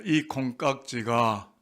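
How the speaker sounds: slow, measured phrase to repeat; slow model reading. Shown as a demonstration. Preacher leading congregation in a tongue-twister, slow model reading. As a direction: say it slowly for others to echo. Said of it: "저를 한번 따라하겠습니다".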